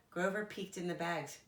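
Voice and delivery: usual speaking voice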